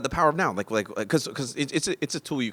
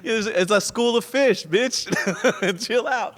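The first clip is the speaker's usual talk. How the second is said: laughing high mock rant; 13 semitones higher, 6 dB louder, slower than usual. Comedian cracking up retelling the geese logic to his friend, high and laughing. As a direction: voice pitched way up, giggling through the words.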